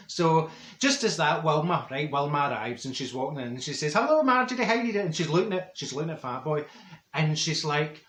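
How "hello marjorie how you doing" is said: high, louder greeting; gushing hello. Retold or imitated. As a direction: sing out the hello to a friend. Wilma arrives greeting loudly.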